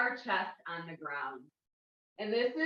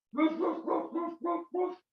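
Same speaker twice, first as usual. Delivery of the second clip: louder, higher woofs climbing up; big dog woofs; 7 semitones higher, 3 dB louder than usual. Shown as a demonstration. Teacher shows kids the big puppy's louder woofs. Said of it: "so it gets louder".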